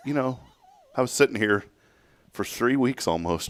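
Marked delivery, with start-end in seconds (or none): none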